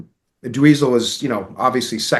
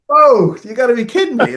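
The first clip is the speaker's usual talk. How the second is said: loud, high "whoa" of astonishment; retold incredulous outburst; amazed disbelief; 11 semitones higher, 6 dB louder than usual. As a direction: reenact being floored, voice jumping up.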